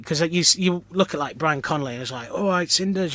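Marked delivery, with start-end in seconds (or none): 2.3-3.1 put-on cheeky London geezer accent; quick imitation of geezery London. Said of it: "London, like, "We," geezery".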